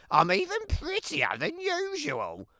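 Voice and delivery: put-on a sweet, self-admiring child's voice; vain little girl. Little Red voiced by narrator.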